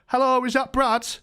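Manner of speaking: high, put-on posh London phone greeting. Landlord ringing his tenant for the first time, mock posh London. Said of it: "This very posh London voice".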